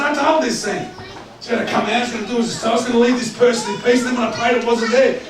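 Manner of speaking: high, agitated retelling; retold exasperated complaint. Preacher rings his friend after failed prayer, acted exasperation.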